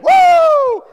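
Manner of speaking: very high, loud cheering "woo"; hammed-up high crowd whoop; American whoop. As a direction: let out a huge hollering whoop. Mimics Americans cheering for themselves.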